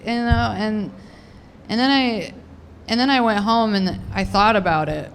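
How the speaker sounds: usual speaking voice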